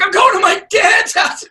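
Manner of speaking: loud, very high mock shout; mock outburst. Upset husband leaving for Dad's, high mock shout. Imagined husband storming off crying.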